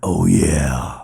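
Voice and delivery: Randy Savage impression. Host imitates Randy Savage hyping the crowd, loud wrestler growl. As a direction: hype it up like a wrestler cutting a promo. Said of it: "it was Randy Savage".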